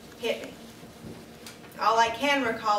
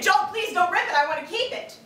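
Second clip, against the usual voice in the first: much higher, louder, frantic begging; wife begging husband in earnest, higher and louder; desperate pleading; 8 semitones higher, 3 dB louder than usual. Acted. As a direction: plead as if the dress truly matters. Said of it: "Like you mean it".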